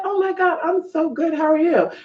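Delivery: high, gushing small talk; overfriendly reply. Retold or imitated. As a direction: put on high-pitched chatty politeness. Acts her overfriendly reply to stranger.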